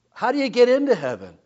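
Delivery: loud, fast rhetorical question; preaching punch. Acted. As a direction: hammer the question at the crowd.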